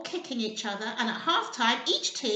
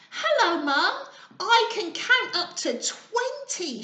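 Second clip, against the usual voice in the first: excited; 7 semitones higher, 4 dB louder than usual. Acted. Excited child spider greets mum.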